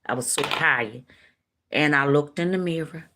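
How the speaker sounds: ordinary delivery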